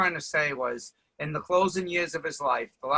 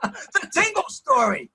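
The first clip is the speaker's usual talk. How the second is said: slow, high grand announcement; mock grand title; 12 semitones higher, slower than usual. Friend proclaiming his own movie: slow mock-grand title.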